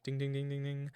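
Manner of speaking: soft, level run of dings; imitated alarm ding. Narrator imitates the alarm waking you, soft monotone dinging. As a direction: chime like an alarm on one note, quietly.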